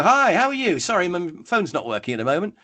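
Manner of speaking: high, bubbly mock-enthusiast greeting. Enthusiast answering the phone, high and excited. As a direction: burst in high and breezy, imitating an enthusiast. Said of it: "whereas the enthusiast will probably".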